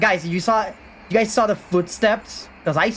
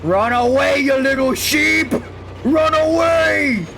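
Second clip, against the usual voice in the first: haunted house actor; 7 semitones higher than usual. Acted. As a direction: chase them off, taunting. Chasing survivor, taunting.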